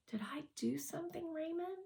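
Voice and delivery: put-on girl asking if she upset him, hurt and quiet. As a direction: ask it small and wounded. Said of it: "She looked hurt".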